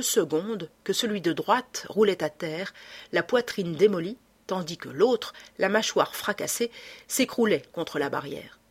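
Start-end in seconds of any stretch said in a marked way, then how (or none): none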